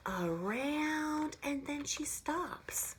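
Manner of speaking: teacher tracing a circle, speaking slowly; slow drawn-out demo. Shown as a demonstration. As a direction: stretch each word slowly as you trace. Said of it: "Just like this".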